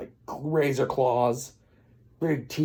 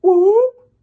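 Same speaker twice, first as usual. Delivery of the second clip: sudden loud, high-pitched whoop; 14 dB louder than usual. Acted. Pinocchio's nose suddenly grows, loud high whoop. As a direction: whoop like something shooting out.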